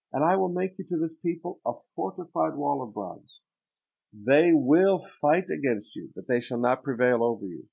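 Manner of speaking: loud, measured scripture reading from the pulpit. Acted. Preacher reads Jeremiah's lament to the church, declaiming. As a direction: proclaim the prophet's words to the congregation.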